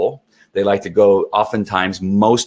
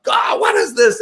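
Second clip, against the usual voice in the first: high, startled mock shout; confused dog; 13 semitones higher than usual. Trainer voices the dog hit by stimulation, confused cry. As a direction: cry out in sudden bewilderment. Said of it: "the dog's, like, confused".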